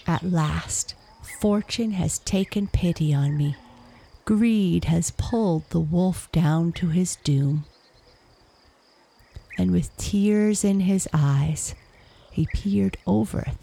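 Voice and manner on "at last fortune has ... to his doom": usual speaking voice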